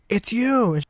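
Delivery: very high excited exclamation, imitated; mock surprised cry. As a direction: pipe up in startled recognition. Ex-boyfriend suddenly recognizes him.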